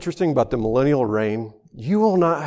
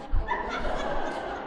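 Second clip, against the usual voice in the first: breathy laugh; 9 dB quieter than usual. Real, not acted. Laughs at own joke.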